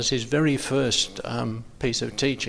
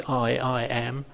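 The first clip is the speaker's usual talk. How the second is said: slow, weighty I, I am; emphatic I am; slower than usual. Shown as a demonstration. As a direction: land hard on I, I am. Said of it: "extremely emphatic".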